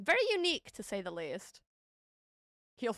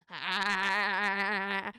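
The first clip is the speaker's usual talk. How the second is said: long, steady, vibrating "aaah"; drawn-out "ahh"; 3 dB louder than usual. Real, not acted. Streamer making noise on stream, drawn-out "ahh". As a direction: hold the vowel on one note.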